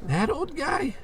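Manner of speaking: put-on can't believe that old guy; shocked disbelief. Hears she dated old man.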